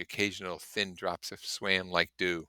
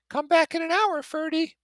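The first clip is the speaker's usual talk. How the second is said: very high, loud, gushing socialite; Daisy's rippling voice; 6 dB louder than usual. Acted. Daisy gushing to her cousin from the car, very high and bright. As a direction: sing it out like a delighted society girl.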